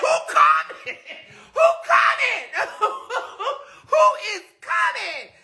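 high-pitched giggling question; laughing high squeal. Retold or imitated. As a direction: squeal "Who coming?" high through laughter. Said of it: "I said".

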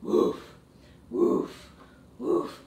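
short playful woofs; puppy bark. Pretend. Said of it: "let me see your puppy dog pose".

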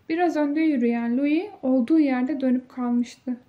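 usual speaking voice

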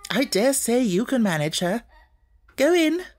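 butter her up; approving. A narrator's reading. Headmistress changing her manner to flatter Sarah, approving. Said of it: "in her approving way".

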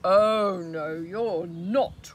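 bellowing refusal; roaring troll. Acted. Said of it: "roared the troll".

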